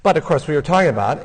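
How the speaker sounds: ordinary delivery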